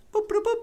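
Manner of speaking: high chirpy computer boops; high robotic boop boop boop; computer beeps. Acted. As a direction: beep like a ship's computer. Computer beeping noises.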